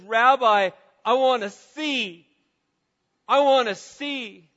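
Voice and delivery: loud, high, slow plea; crying out. Retold or imitated. Preacher voicing blind man's plea to Jesus, crying out. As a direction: cry it out with full voice, drawing out the words. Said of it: "The blind man just cries out".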